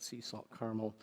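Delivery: ordinary talk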